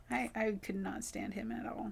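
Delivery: whispered confession. Real, not acted. Coworker whispering her dislike of a tenant. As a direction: hush it like a secret.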